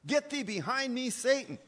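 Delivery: high, forceful rebuke; Jesus rebuking Peter. Retold or imitated. Jesus turns on Peter over his natural thinking, forceful high rebuke. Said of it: "when Jesus looked at Peter and said this".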